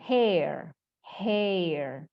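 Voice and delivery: slow, separated "hair", falling; correct pronunciation. Shown as a demonstration. Teacher modelling the word for students, correct pronunciation. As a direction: stretch the vowel in "hair".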